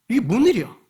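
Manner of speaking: imitated shocked outburst; acted astonishment. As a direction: act out dumbfounded onlookers' disbelief. Voicing astonished religious leaders.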